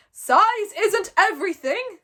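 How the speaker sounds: slow, loud, high booming chief; booming Stoic. A narrator's reading. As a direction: boom it out like a proud chieftain. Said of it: "boomed Stoic".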